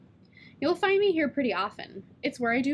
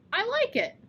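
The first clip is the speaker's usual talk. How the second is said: put-on little girl; 11 semitones higher than usual. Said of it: "and said".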